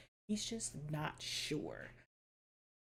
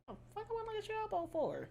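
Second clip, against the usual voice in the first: much higher, fast incredulous cry; incredulous outburst; much faster than usual. Retold or imitated. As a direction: blurt it out in disbelief. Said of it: "You're like".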